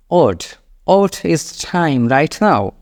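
"What" said as "ot", sentence very slow. Shown as a demonstration. Teacher corrects learners, slow "What" pronounced "ot". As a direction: drop any H, start "What" on a round O. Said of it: "এইভাবে বলবেন".

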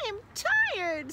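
high little whine of tiredness; complaining young frog. A narrator's reading.

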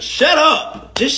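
exasperated shut up; sharp shut up. Real, not acted. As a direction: cut him off hard. Snapping at mocked rapper.

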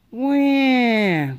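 high put-on baby wail. A narrator's reading. Baby Polly crying for her mother, high wail. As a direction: wail like a newborn. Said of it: "cried Polly".

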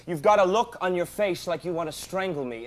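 ordinary talk, nothing marked

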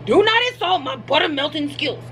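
half-hearted sassy lecture; sassy scolding. Pretend. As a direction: playfully tell them off. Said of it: "I'm, like, yelling at you guys".

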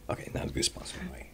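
dry, whispered and fast; deadpan whisper. Retold or imitated. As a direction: deflate the moment with a dry aside. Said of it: "I'm like".

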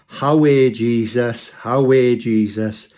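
slow, drawn-out Geordie cry; Geordie accent. Retold or imitated. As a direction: call out in broad Geordie, stretching each word. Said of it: "in a Geordie accent, she shouted out".

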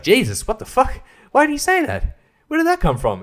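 high-pitched disbelief at a movie line; mock shock. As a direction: play a stunned audience member. Mock shocked viewer reaction.

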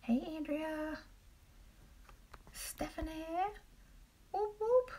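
a high little cheer. Real, not acted. Greeting viewers joining live.

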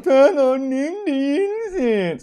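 high, slow, drawn-out wail; hammed-up childish dismay; mock distressed child. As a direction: whine it like a lost little kid. Child realises woman isn't mum.

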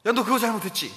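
how a parent should not confront, mock scolding. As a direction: call the child out sharply. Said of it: "이렇게 얘기하는 게 아니라".

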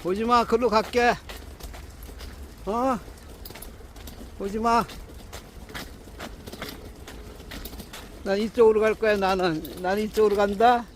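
talking to a dog. Real, not acted. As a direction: call out brightly to your pet. Calling to the dog on walk. (강아지에게 높고 크게 부르는 말투)

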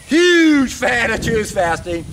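"Huge" drawn out slowly, high and loud; stress on Huge. Real, not acted. Fan outdoing his praise of water fasting, "Huge" drawn out emphatically. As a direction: stretch and punch the word "Huge".

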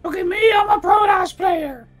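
high squeaky pretend dog voice; puppy voice. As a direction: speak for the puppy. Voicing the puppy.

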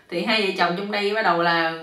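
ordinary delivery

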